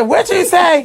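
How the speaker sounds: high, loud mock mom reply; imitating mom. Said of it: "And she said".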